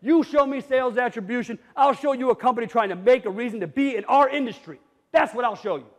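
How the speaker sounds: ordinary talk, nothing marked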